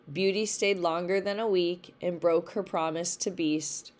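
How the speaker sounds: ordinary delivery, nothing marked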